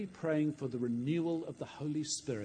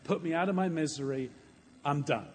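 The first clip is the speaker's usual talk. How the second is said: raised plea sinking towards resignation; exasperated surrender, easing down from the outburst; Moses giving up; 4 semitones higher, 5 dB louder than usual. Acted. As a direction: beg to be let off, worn out. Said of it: "cries out to God".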